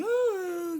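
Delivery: very high falsetto "aah"; hammed-up woman's wail, squeaked out; mock dying cry. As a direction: let out a silly high cry. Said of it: "qui fait".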